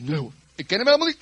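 loud, quick denial; emphatic denial. Acted. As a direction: brush the child off loudly. Peter denying a second time.

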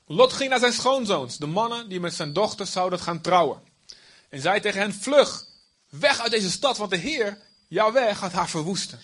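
dramatic scripture reading, crowd and angels voiced; Bible story reading. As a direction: read it aloud like a gripping story. Reads Lot warning in-laws.

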